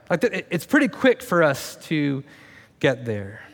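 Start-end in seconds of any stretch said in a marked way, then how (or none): none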